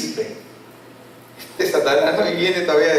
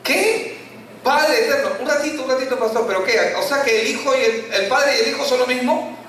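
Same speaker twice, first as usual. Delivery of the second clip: higher-pitched, incredulous questioning; baffled interruption; 4 semitones higher than usual. Acted. Puzzled churchgoer questions pastor about Father and Son, baffled. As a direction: stop the pastor in puzzled disbelief.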